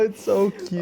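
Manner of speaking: usual speaking voice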